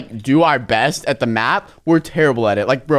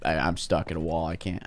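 ordinary talk

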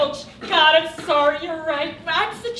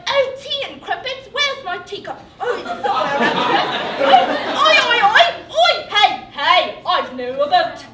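high, loud Cockney caricature; hammed-up British parody, escalating into shouts; mock British accent; 6 semitones higher, 6 dB louder than usual. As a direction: ridicule mom with a silly British accent. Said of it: "tell that to your accent".

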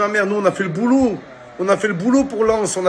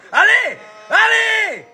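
high, slow shrieking cheer; shrieking jackpot winner; 10 semitones higher, 4 dB louder than usual. Real, not acted. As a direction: shriek it like you just won. Cheering on team.

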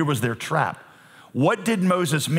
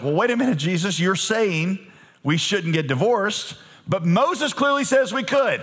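mock protest; 6 semitones higher than usual. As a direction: pile on the indignation in a high voice. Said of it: "And they're like".

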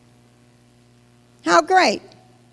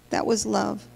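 softer, dropped to a near hush; hushed repeat; 3 semitones lower, 8 dB quieter than usual. Acted. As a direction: let the words sink in quietly. Repeats line, second time hushed.

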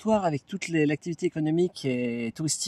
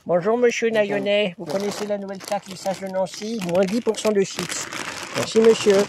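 chip tax collector; 4 semitones higher than usual. Pretend. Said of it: "Je suis le percepteur des chips".

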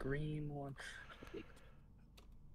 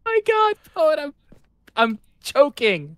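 high loud panic; panicked; 12 semitones higher, 9 dB louder than usual. Real, not acted. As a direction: blurt it out in a panic. Panics mid-jump.

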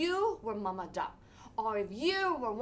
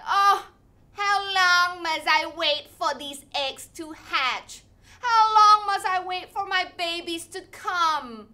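weary mama duck; 5 semitones higher, 11 dB louder than usual. Acted. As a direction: moan it like a tired mother duck. Mama duck voiced complaining.

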